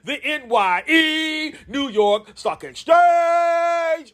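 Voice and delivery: hype shout. Acted. Hypes up audience stock exchange.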